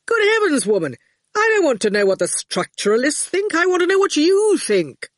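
mock-bellow at a student; exasperated yell. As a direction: lecturer losing patience at a student. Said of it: "They heard him yelling".

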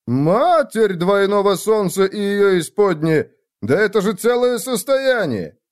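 high, drawn-out excited exclamation; astounded delight. Acted. Friend learns of the gold winnings, astounded and delighted. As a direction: marvel loudly at a fortune. Drunk friend hears the sum.

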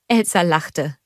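plain reading voice

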